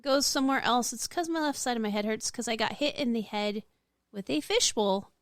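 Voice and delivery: usual speaking voice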